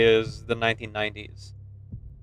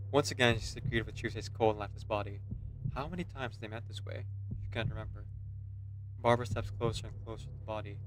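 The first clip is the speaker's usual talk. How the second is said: story narration; 10 dB quieter than usual. Acted. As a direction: read the scene quietly like a storyteller. Reads game story text.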